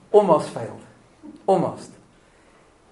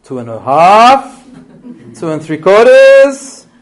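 stalling count; 3 semitones higher, 10 dB louder, slower than usual. Pretend. Parent stalling the count at a defiant child, loud and slow. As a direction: drag each fraction out like a desperate warning.

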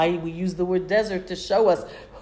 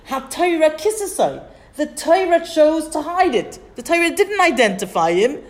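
higher and louder, retelling an outraged objection; indignant protest; 9 semitones higher, 6 dB louder than usual. Retold or imitated. Rabbi protesting to Rabbi Akiva, indignant and raised. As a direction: press the point with mock outrage.

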